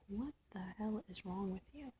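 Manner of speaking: inner-voice whisper; stunned whisper to yourself; whispered thought. Retold or imitated. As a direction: breathe it as a private thought. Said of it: "I'm sitting there in my head like".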